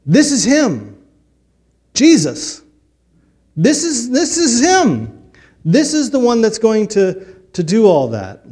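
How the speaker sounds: preacher's climax, proclaiming the king to the congregation; preaching at full force. Acted. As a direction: ring it out from the pulpit: this is him.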